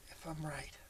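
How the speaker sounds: whispered aside to himself; whispering. Real, not acted. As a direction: breathe it out under his breath. Unsure aside while working.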